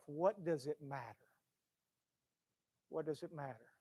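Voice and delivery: low, soft repeated question; hushed question. Real, not acted. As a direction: let it sink in quietly. Preacher drops to hushed repetition.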